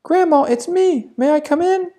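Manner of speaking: put-on a polite child at the door; little girl. (the girl at grandma's door, high child's voice)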